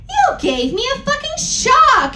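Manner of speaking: hammed-up high angry shout. Reading the fanfic aloud, a hammed-up angry shout. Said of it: "I shouted angrily".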